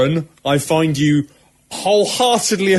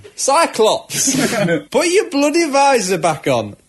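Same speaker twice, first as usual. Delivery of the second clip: cockney telling off; 7 semitones higher than usual. Retold or imitated. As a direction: bark it like a cockney boss. Cockney professor scolds Cyclops.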